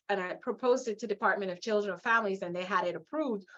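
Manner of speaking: normal talking voice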